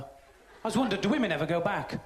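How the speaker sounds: ordinary talk, nothing marked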